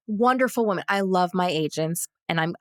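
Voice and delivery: usual speaking voice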